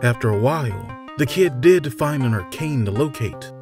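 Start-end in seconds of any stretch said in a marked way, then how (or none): none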